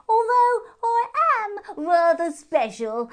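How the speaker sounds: very high, loud and slow; proud hare. A narrator's reading. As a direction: puff up, a hare sure of his own importance. Hare character voice.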